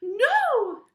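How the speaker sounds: very loud, high witch's scream of no; cried out. A narrator's reading. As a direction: shout it in alarm as the witch. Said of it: "cried the witch".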